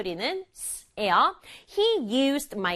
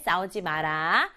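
normal talking voice

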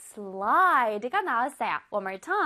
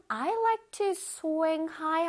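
slow read; 3 semitones higher, much slower than usual. Shown as a demonstration. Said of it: "teacher reading example".

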